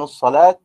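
emphatic final ta on الصلاة; pausal ta. Shown as a demonstration. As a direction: stop الصلاة on a sounded t. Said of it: "من وقف بالتاء".

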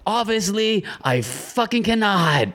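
very high, slow mock of a sneering driver; mocking the rude driver's retort, high and drawn out. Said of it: "he goes".

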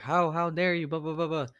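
high, hammed-up how dare you; mock indignant. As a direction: play up the outrage you never actually voiced. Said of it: "I didn't say, "How dare you?".

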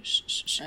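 shh suave sin voz; arrullo susurrado. Shown as a demonstration. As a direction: calla al bebé con un shh. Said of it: "le haces así". (chistido suave para dormir al bebé)

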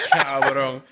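ordinary talk, nothing marked

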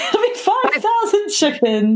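words bubbling through real laughter, high and slow; laughing. Real, not acted. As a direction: giggle through the line. Laughing at the chicken joke.

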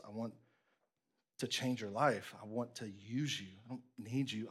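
low, hushed and heartfelt; tender, earnest appeal. Acted. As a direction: plead gently and intimately as a loving Lord. Jesus pleads gently with listener.

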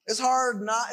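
ordinary talk, nothing marked

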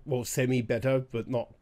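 ordinary talk

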